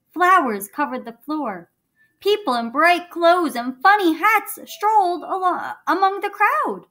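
lively storybook reading; reading to children. A narrator's reading. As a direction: read it like a bedtime story.

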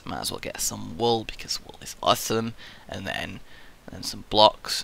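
softly whispered aside. Real, not acted. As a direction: whisper it to yourself while gathering. Muttering while gathering items.

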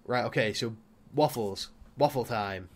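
usual speaking voice

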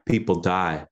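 usual speaking voice

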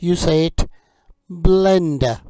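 slow, loud, carefully clear teacher drill; vocabulary drill. Acted. Teacher drilling kitchen words for learners, slow and clear. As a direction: model each word for learners to repeat.

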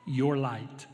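hushed, earnest preaching. Real, not acted. Preacher telling listeners their light is coming, hushed earnest preaching. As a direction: bring it down to a quiet, sincere assurance.

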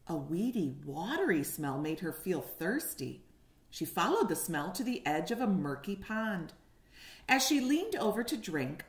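put-on lively storybook reading for little kids; reading to children. A narrator's reading. Teacher reading a picture book to kindergartners, animated. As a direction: paint each moment of the story for the class.

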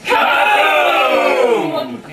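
big explosive kaboom; loud, high, drawn-out boom; group shout. Pretend. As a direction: shout it out like a blast. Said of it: "Now everyone say".

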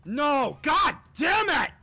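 loud frustrated gamer yell; angry-sounding shout. Real, not acted. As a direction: shout in exasperation, voice jumping up. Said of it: "I'm shouting and I sound angry".

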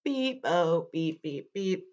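low monotone robot. Acted. Storyteller shows kids how to talk robot, monotone robot voice. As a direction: speak on one note like a machine. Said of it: "talk like a robot".

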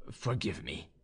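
low, soft plea; subdued apology. A narrator's reading. Young man apologises as she draws away, low and soft. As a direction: drop to a hushed apology.

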